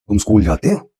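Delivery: हो rising at the end; plain question rising on हो; teacher's closing intonation demo. Shown as a demonstration. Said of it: "एक ही sentence को intonation के साथ".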